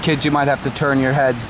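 usual speaking voice

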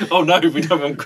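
high, giggling protest; laughing. Real, not acted. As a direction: laugh through it. Laughing about crab legs.

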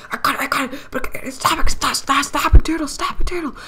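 high, loud frantic yelling at a turtle; rising to a squealing yell; panicked shouting. Retold or imitated.

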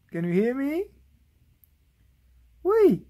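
usual speaking voice

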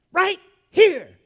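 very loud, high two-word command; sharp voice. Retold or imitated. Aunt orders grandson in front of her, sharp shout. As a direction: imitate an aunt ordering him to the spot. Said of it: "that sharp voice".

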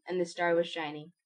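ordinary delivery, nothing marked

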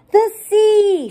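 put-on excited children's shout. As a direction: call it out like a class of kids. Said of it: "shouted the children".

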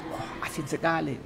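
softly voiced complaint about salty food; wife's soft complaint. Wife tasting the husband's salty cooking, mild soft complaint. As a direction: lightly mimic the wife's mild protest. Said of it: "kayak istri saya kalau makan masakan saya".

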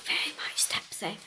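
breathy whispered aside. Real, not acted. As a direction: whisper it like a secret. Whispered aside about being tipsy.

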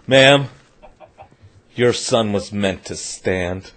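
low, slow doctor's pronouncement; solemn doctor. Acted. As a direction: deliver it gravely, low and slow, with pauses. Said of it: "the doctor said".

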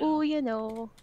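put-on loud, stretched Canadian accent; lightly put-on Canadian accent. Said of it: "I said it in a Canadian accent like you".

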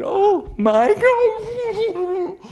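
high, stretched-out cry of shock. Acted. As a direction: gasp it out in terror. Character sees huge elk.

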